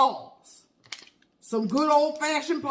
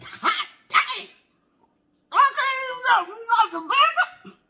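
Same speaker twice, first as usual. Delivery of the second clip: outraged exclamation; 8 semitones higher than usual. Retold or imitated. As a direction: blurt it out half laughing. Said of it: "I said".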